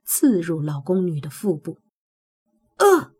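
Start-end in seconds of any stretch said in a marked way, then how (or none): 2.8-3.1 sharp high pained cry; a sudden stabbed shriek; short pained cry. Acted. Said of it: "老宫女短促地尖叫了一声".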